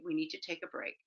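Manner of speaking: soft, calm statement. Acted. Parent disengaging from an arguing kid, softly calm. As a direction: say it quietly, without any push. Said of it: "You want to calmly say".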